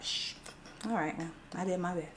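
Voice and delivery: quiet. Real, not acted. Quiet resigned aside.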